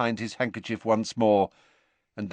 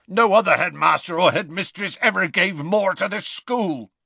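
growled Hagrid; 10 semitones higher, 5 dB louder than usual. A narrator's reading. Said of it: "growled Hagrid".

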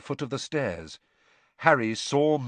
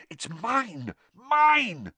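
high, breathy urgency building louder; urgent mouthing; 11 semitones higher, 4 dB louder, much slower than usual. A narrator's reading. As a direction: signal desperately, rising to a high cry.

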